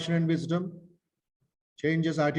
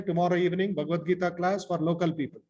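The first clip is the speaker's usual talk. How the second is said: ordinary talk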